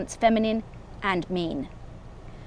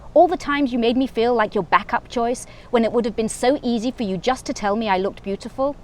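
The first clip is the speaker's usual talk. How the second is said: ordinary delivery, nothing marked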